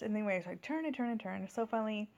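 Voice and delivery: ordinary talk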